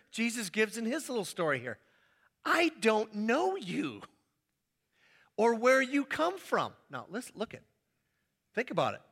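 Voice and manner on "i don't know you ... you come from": slow, deliberate "I don't know you"; slow refusal. Acted. House owner refusing the stranger, slow and firm. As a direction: refuse them, slowly and firmly.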